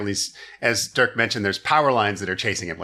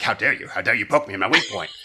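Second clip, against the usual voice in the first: offended Master; 3 semitones lower, faster than usual. Retold or imitated. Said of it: "he's like".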